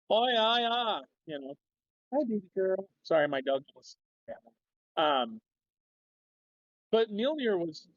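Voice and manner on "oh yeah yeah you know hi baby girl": Minnesotan accent. Shown as a demonstration. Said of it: "I go half Texan, half Minnesotan".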